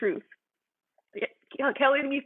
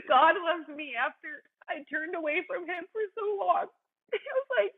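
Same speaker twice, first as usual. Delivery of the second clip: laughing as she speaks, a little higher; laughing through tears; 7 semitones higher than usual. Real, not acted. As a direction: get the words out through joyful laughter. Laughing in joy mid-testimony.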